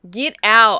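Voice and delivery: loud, firm order to leave; dead serious, loud get out; angry get out. Real, not acted. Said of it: "I'm dead serious".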